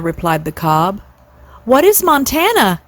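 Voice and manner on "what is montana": high curious question; eager question. A narrator's reading. Cygnets asking their father, high and eager. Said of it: "asked all the cygnets".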